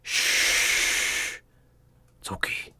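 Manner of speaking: hushed, muffled through a covered mouth; muffled whisper. Acted. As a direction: whisper it from behind a mask. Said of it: "out of a muffled, um, mouth".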